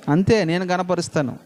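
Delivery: usual speaking voice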